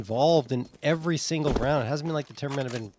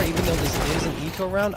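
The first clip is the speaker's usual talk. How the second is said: ordinary talk, nothing marked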